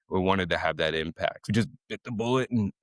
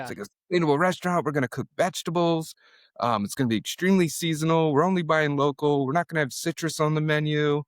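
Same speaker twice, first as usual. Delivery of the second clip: higher and fast, a lightly put-on earnest voice; mock earnest pitch; 8 semitones higher than usual. Chef mimicking himself pitching investors, quick higher mock voice. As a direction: rattle off the pitch like an eager idealist. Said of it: "We're like".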